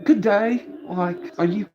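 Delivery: police officers. Retold or imitated. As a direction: put on a high voice for visiting police. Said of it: "they were all like".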